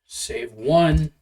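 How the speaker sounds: slow, soft scene setting; slow narration. Acted. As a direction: describe the scene slowly.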